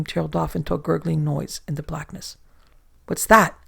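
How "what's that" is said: sudden alarmed shout. A narrator's reading. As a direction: call out in alarm into the dark. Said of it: "he shouted to his companions".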